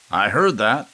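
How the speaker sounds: loud, high angry shout; furious old man shouting. A narrator's reading. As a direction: bark it from across the room. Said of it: "shouted Gramps".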